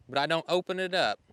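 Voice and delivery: ordinary delivery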